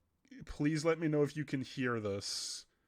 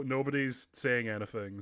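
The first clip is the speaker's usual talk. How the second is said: ordinary talk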